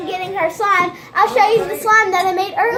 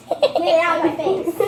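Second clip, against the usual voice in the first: ordinary talk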